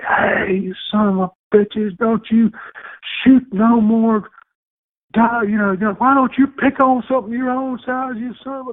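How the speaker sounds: high shrill mock cussing; angry old woman. Lady yelling at hunters shooting her roof, high mock tirade. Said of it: "she was like".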